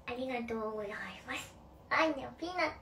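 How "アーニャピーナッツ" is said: put-on a light childish Anya voice; lightly imitating the little girl Anya; a hint of a cartoon girl; Anya impression. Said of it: "ちょっと似てないわ".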